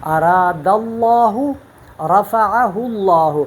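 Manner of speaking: very slow اراد اللہ and رفعہ اللہ with a full, heavy lam; اللہ said with a heavy, rounded lam; heavy tafkheem. Shown as a demonstration. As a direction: recite both phrases with a thick lam. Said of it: "جس طرح کہ میں نے پڑھا".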